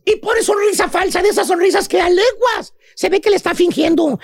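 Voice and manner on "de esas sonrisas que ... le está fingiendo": ordinary talk, nothing marked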